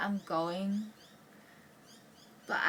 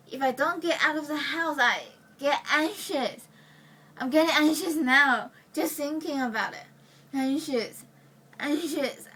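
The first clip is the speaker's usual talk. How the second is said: worked up, louder and higher; growing anxiety; 4 semitones higher, 6 dB louder than usual. Acted. Anxious speaker confessing they must get out of the house, agitated. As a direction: let the panic push the voice up. Monologue, working up anxiety about being indoors.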